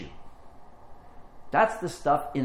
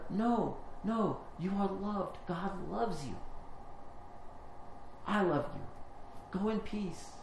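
tender blessing; 4 semitones higher, 9 dB quieter, slower than usual. Retold or imitated. Comforting a crying stranger.

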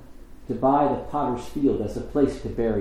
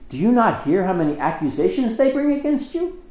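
baffled Pilate; 8 semitones higher than usual. Acted. Pilate presses silent Jesus.

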